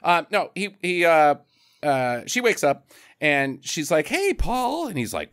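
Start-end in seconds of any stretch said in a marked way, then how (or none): 4.1-4.9 high put-on lady voice; imitated woman, much higher pitched; woman's voice. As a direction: greet him in a high feminine lilt. Voicing a woman waking up.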